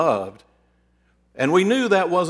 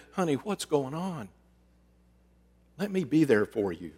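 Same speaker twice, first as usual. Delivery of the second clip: softer, gentle concern; tender spouse; 6 dB quieter than usual. Pretend. Spouse gently asking a struggling partner, tender and soft. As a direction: ease in gently, like soothing a partner.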